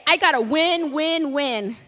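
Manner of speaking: hammed-up will to win; competitive player. Preacher mimics a competitive Jenga player. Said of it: "no salen bien competitivos".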